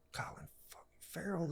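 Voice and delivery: hushed, soft whisper to himself; starstruck whisper. Retold or imitated. Seeing Colin Farrell in the elevator, whispered to himself. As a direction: whisper it in disbelief.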